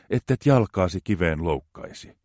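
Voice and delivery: ordinary talk, nothing marked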